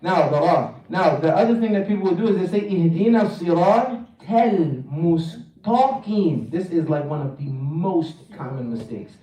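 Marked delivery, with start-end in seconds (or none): 2.7-6.5 "siratal mustaqim" slowly, light tal and heavy taw; thin the t in "siratal", thicken it in "mustaqim"; swapped ta letters. Shown as a demonstration. Teacher quoting a common mistake, "siratal mustaqim" with t sounds swapped. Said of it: "Turn the ta into ta".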